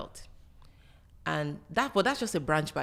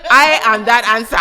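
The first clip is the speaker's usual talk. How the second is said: loud, higher, laughing proclamation; triumphant declaration; 5 semitones higher, 18 dB louder than usual. Real, not acted. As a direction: boast it loudly through laughter. Boastful punchline amid laughter.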